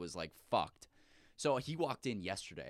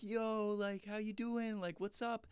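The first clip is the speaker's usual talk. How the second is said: much higher, mocking party-crowd voice; imitated drunk group greeting; 11 semitones higher, slower than usual. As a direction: imitate the plastered group saying hello. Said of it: "all of them were like".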